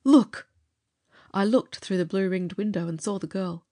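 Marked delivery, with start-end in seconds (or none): 0.0-0.5 high, loud alarmed cry; horrified shout. A narrator's reading. Charlie pointing at the window, a loud high alarmed shout. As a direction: shout the warning at full pitch. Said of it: "he screamed in sudden horror".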